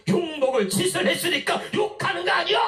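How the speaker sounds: 아주 높은 목소리로 내지르는 고함; 따지듯 새된 소리로 외치기; 고함치는 군중 흉내. Acted. 욕하는 사람들의 대답 흉내. (욕하는 사람 흉내로 버럭 고함)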